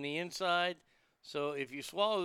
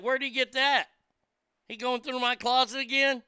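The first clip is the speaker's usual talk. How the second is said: mock indignation; 8 semitones higher, 7 dB louder than usual. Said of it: "I'd be like".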